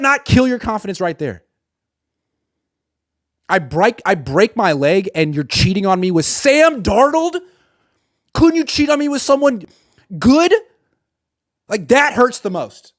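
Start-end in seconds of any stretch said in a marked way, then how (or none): none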